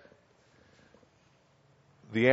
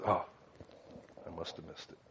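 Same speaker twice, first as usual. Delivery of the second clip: meek mumble; 7 semitones lower, 18 dB quieter than usual. Retold or imitated. Mimics own doubtful response.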